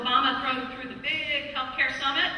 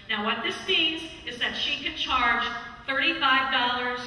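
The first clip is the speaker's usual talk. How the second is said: normal talking voice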